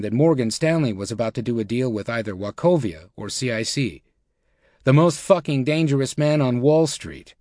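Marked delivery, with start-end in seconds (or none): none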